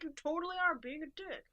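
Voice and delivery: high, loud retort in a retold quarrel; exaggerated indignant comeback; mock insistence. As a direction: argue back, hammed-up and emphatic. Acts Clara arguing back.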